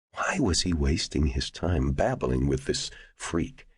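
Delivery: ordinary delivery, nothing marked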